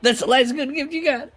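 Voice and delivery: very high, loud startled yelp; a sudden high "oh shit" on realizing the slip; real startled outburst. Real, not acted. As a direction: blurt it out as if caught out. Caught forgetting friend's gift.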